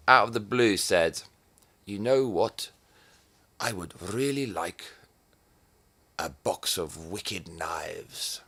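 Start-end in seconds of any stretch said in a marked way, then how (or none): 1.9-8.4 mock dad voice. Imitating dad asking for knives, slow and soft. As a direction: drag out the wish in a dreamy, slowed mock voice. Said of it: "he didn't say it like that at all".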